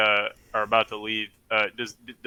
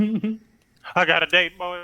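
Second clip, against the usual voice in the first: smug brag; 7 semitones higher, much slower than usual. Acted. Character bragging about a date.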